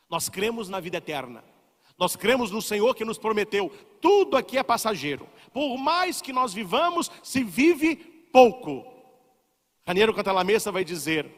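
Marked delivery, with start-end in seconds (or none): 5.5-8.9 emphatic preaching. Acted.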